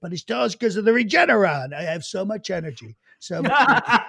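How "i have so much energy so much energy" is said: strained, tired president impression; imitated weary boasting; Trump. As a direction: push out bravado through a tired voice. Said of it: "straining to talk".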